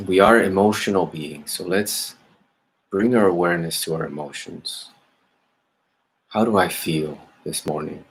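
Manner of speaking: calm, measured meditation guidance with long pauses; guided meditation. Acted. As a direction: gently lead listeners inward, leaving space after each phrase. Leads a meditation.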